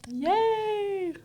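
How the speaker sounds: high, long-held "yay"; drawn-out cheer. Acted. Cheering the movie release.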